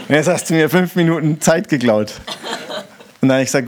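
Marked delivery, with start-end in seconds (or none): 0.1-2.1 a mock complaint through laughter; laughing complaint. Preacher telling the stranger he stole five minutes, laughing. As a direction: grumble while laughing. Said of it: "Da sag ich".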